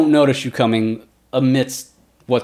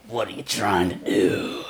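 glaring bugbear; 5 semitones lower, 5 dB quieter than usual. Acted. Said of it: "he just glares at you".

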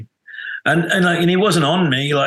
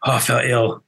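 usual speaking voice

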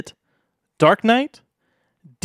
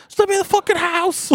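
high-pitched mock yell, no real volume; Mark Wahlberg yelling. Villain demanding entry at the peephole, high mock yell. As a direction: imitate a movie villain's furious yell, pitched way up. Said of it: "when he's yelling at that".

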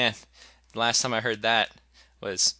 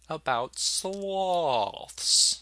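super slow sleepy sloth; 6 semitones higher, much slower than usual. Acted. Announcing topic, drawn out.

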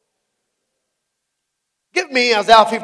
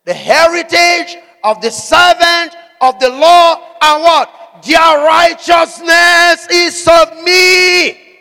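slow, loud, higher climax; preaching at full force; 7 semitones higher, 6 dB louder, much slower than usual. Acted. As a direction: build it to a thundering close. Preacher climaxing scripture reading.

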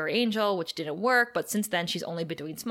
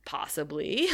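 lower, understated imitation; chill reply; 4 semitones lower, 4 dB quieter, slower than usual. Giles answering the eager student about a spell, chill. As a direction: play it cool and noncommittal. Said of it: "like, chill".

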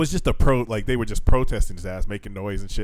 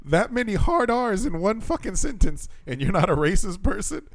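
mock disbelief; 10 semitones higher than usual. Host reacting to the man's denial, high mock disbelief. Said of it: "And I was like".

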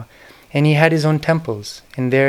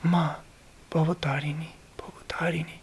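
reverent whisper; 4 semitones higher, 6 dB quieter than usual. Retold or imitated. Guru whispering his prayer to the Divine Mother, reverent whisper. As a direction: whisper the holy name as if in prayer. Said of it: "I remember he would say".

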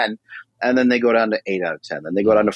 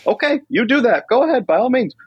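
amused; 12 semitones higher than usual. Retold or imitated. Amused reply to friend.

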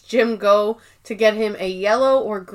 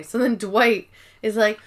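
ordinary talk

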